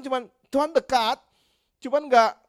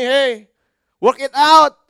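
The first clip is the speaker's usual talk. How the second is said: stern command; 6 semitones higher, 12 dB louder, much slower than usual. Acted. God scolding couples in sermon.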